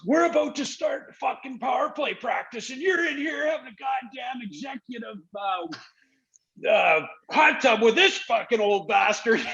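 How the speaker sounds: high, strained mock shout of a furious coach; Brof's angry yell. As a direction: bark it out like a coach caught off guard, way up high. Coach yelling at player in hot tub.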